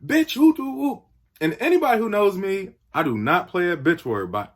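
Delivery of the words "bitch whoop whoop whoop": imitating an enraged guy, high-pitched rant; mock-furious cursing in a much higher voice; livid. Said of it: "he is livid".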